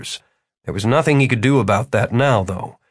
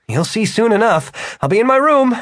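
put-on young man voice; 10 semitones higher than usual. Martin speaks.

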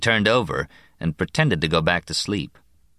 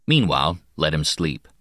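ordinary delivery, nothing marked

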